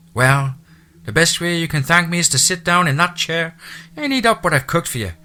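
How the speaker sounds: a chatty Irish housekeeper's brogue; thick Irish brogue. A narrator's reading. Irish housekeeper welcoming the new owner, thick Irish brogue. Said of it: "her lyrical accent".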